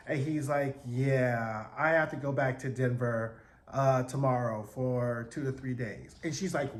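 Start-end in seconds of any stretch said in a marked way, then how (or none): 0.8-6.2 put-on low, dragged-out boyfriend voice; slow, lowered voice for a reluctant admission; mock reluctant boyfriend. Boyfriend tells her he must go back to Denver, mock reluctant. Voicing Greg breaking reluctant news.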